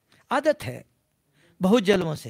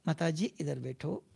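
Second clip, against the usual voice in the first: soft, lower, loving invitation; said lovingly; 5 semitones lower, 7 dB quieter than usual. Shown as a demonstration. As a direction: invite the mother to sit, gently. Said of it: "बहुत प्यार से भी बोल सकते हैं".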